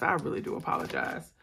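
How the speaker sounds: soft, breathy, tired apology; whispered apology. Real, not acted. Tired host quietly apologizing to her viewers. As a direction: let the apology fade to a whisper. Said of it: "normally I'm all energetic".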